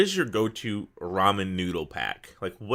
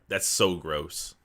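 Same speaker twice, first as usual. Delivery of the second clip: ordinary delivery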